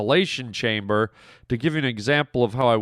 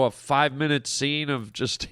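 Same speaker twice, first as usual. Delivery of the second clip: normal talking voice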